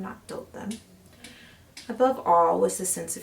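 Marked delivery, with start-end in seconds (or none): 0.0-0.7 breathy secret whisper, nearly voiceless. Acted. Murderer confiding to listener, in a whisper. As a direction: let the words die into a whisper. Confessing narrator drops to whisper.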